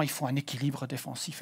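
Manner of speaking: usual speaking voice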